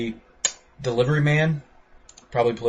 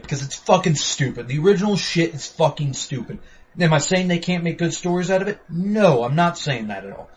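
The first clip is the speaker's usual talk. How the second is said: normal talking voice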